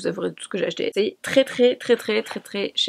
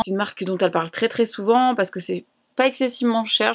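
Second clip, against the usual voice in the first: ordinary delivery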